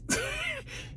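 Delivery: quick shrill-free giggle burst; squeaky high laugh. Real, not acted. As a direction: let a tiny high laugh slip. Laughing mid-comment.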